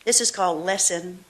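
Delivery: usual speaking voice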